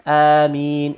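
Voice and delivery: a prolonged, audible Ameen; elongated Ameen. Shown as a demonstration. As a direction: stretch out Ameen as amiin, long vowel. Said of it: "you can elongate it".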